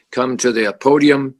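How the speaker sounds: usual speaking voice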